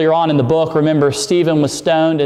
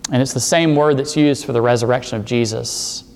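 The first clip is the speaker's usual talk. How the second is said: normal talking voice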